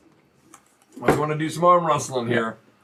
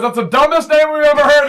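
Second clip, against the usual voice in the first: mocking crowd; 11 semitones higher, 7 dB louder than usual. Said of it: "everyone goes".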